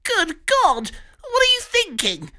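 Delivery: gasping high terror; terrified Pig. Acted. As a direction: shriek it in high panic. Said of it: "Pig gasped".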